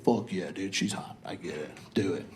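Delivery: low, quiet bro egging him on; dude bro voice. Retold or imitated. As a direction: play the lazy bro friend, pitched low and hushed.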